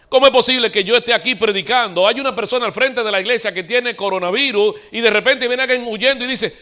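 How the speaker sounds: voz de predicador alta y aguda; prédica enérgica. Real, not acted. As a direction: proclama con fuerza, subiendo el tono. (predicación a plena voz)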